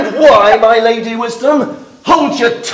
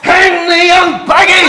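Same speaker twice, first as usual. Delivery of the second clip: furious shout; 9 semitones higher than usual. Acted. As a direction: curse her at full volume. Father curses daughter.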